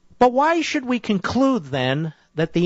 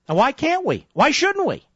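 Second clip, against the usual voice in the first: high-pitched, insistent rhetorical questions; indignant challenge; 9 semitones higher than usual. Real, not acted. Host challenges critics on choosing insurance policies, indignant. Host demands answers in argument.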